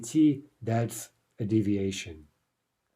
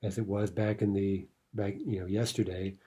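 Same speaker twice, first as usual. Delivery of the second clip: ordinary talk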